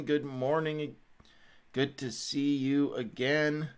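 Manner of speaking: ordinary delivery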